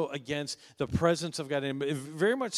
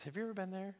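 soft question; 4 semitones higher, 10 dB quieter, much faster than usual. Shown as a demonstration. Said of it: "repeated question, softer then plain".

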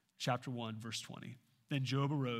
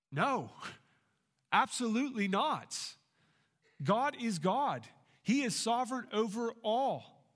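raised, louder preaching, pressing each claim home; preaching at full force; 6 semitones higher, 3 dB louder than usual. Acted. Pastor insisting to his congregation on God's sovereignty, preaching at full force.

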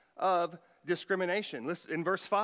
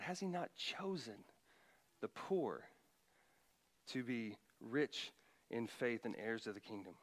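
quiet sarcasm; 5 semitones lower, 9 dB quieter than usual. Shown as a demonstration. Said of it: "sarcasm here".

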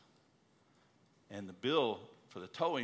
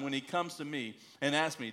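usual speaking voice

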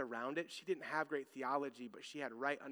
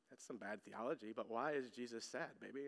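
put-on soft, much quieter, tender question to a crying child; tender dad; 6 semitones lower, 10 dB quieter than usual. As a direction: hush down and comfort her gently. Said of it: "And I'm like".

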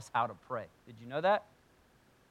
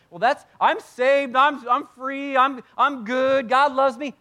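high, loud, drawn-out mock protest; protesting believer; 9 semitones higher, 8 dB louder, slower than usual. Believer excusing himself from the warning, protesting loudly. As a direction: insist in a raised, stretched-out voice.

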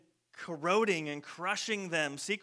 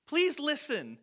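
please pitched much higher, begging to be heard; pleading; 9 semitones higher, 3 dB louder, slower than usual. Shown as a demonstration. Jesus pleading with the crowd, please pitched high. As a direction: plead with them to hear it. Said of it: "Meaning, like".

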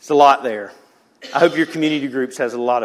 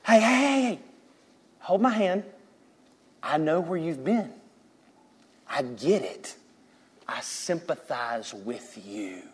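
gentle sympathy; 6 dB quieter than usual. Acted. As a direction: comfort them tenderly, slowing and quieting as you go. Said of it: "come up to us and go".

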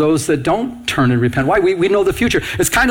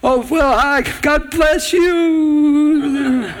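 much higher, very slow sing-song with a laugh; sugary complacent greeting; 11 semitones higher, much slower than usual. Pretend. Pastor mocking complacent believers, sugary slow sing-song. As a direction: drip false sweetness while ignoring the danger. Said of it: "for us to just sit here and say".

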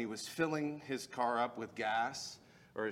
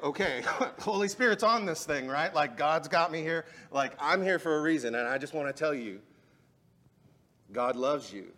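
excited, laughing; 4 semitones higher, 6 dB louder than usual. Retold or imitated. Excited retelling to Carlos.

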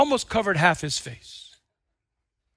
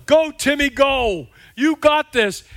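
higher, louder encouragement; cheering; 4 semitones higher, 5 dB louder, slower than usual. Retold or imitated. Family cheering Timmy's third pitch, loud cheer. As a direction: shout from the stands.

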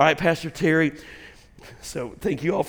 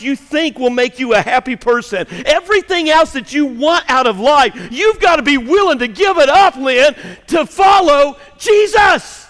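high, loud pulpit exhortation; forceful rising sermon call; impassioned preaching; 8 semitones higher, 12 dB louder than usual. Real, not acted. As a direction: build to a shout on the final name.

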